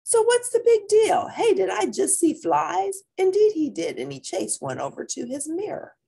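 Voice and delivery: put-on high, loud cat kid voice; kitty boy voice. Kitty boy chasing flies, high and excited.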